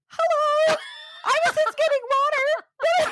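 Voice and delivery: high, stiff, caught-out voice; flustered mess. Retold or imitated. She retells freezing before the police officer, flustered and high. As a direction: imitate yourself frozen and squeaking out excuses. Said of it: "like, a complete mess".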